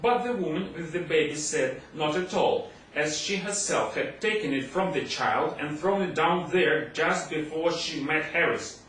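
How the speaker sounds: ordinary delivery, nothing marked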